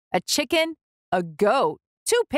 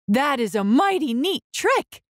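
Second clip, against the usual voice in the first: impressed dog; 6 semitones higher than usual. A narrator's reading. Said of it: "what he thought was".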